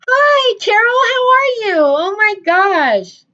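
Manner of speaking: high, loud squeal of greeting; thrilled screaming hello to friends; excited shout. Real, not acted. Greeting friends joining the live.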